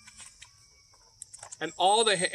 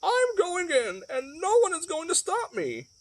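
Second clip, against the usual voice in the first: hen announcing; 11 semitones higher than usual. Acted. Hen announces to the farm animals, high and determined. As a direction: declare it like a bold little hen. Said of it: "she announced".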